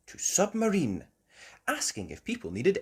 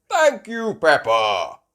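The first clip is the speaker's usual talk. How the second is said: loud, hearty thanks; Grampy Rabbit; 7 semitones higher, 11 dB louder, slower than usual. Acted. Grampy Rabbit receiving his order, loud hearty voice. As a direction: boom it out, starting high.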